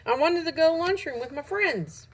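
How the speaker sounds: high, loud complaint; protesting. A narrator's reading. Ravi objects to eating away from friends, high loud protest.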